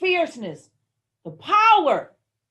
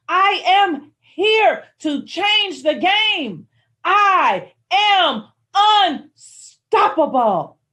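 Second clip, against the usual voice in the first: loud, high and slow, each word declared; mirror affirmations with conviction; 8 semitones higher, 8 dB louder, slower than usual. Shown as a demonstration. Speaker shows the morning mirror affirmations, slow loud declaring. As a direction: preach it to yourself in the mirror. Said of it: "say it with such love and such conviction".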